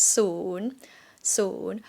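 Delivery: soon dipping then rising, no final y; just soon, rising, no glide; soon without y. Shown as a demonstration. Teacher showing soon without the final y. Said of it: "not gonna pronounce y at the end".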